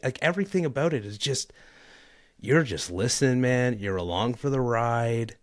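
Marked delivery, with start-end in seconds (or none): none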